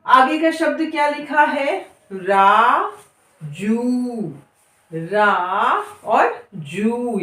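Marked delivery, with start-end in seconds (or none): 2.1-3.8 राजू very slow, the जू held long; slow syllable demo. Shown as a demonstration. Teacher sounding out राजू for beginners. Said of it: "वहां थोड़ा गाना गा के पढ़िए". 4.9-7.2 रा and जू very slow and split; syllable by syllable. Shown as a demonstration. Teacher breaking राजू into syllables. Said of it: "वहां मुंह खोल कर पढ़िए".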